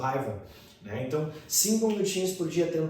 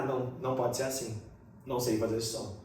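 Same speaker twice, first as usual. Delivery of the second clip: lower, softer self-doubt; doubting learner; 5 semitones lower, 6 dB quieter than usual. Acted. Learner rejecting the strange TH sensation, quiet doubt. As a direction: back off quietly, as if giving up on a sound.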